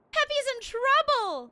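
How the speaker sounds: high, loud alarmed cry; alarmed exclaim. Real, not acted. Gamer exclaiming the dog is in trouble. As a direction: cry it out in a panic.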